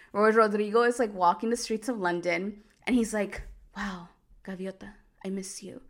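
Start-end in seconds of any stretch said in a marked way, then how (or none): 3.8-5.8 breathy mock-romantic whisper, hammed up; mock lovesick whisper. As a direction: whisper it like a pining soap-opera hero. Lovesick hero murmuring to himself.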